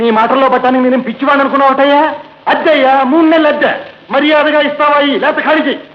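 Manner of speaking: shouting for rent. Acted. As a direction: bark at the tenant for rent money. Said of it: "ఎందుకు sir అంత గట్టిగా అరుస్తారు?".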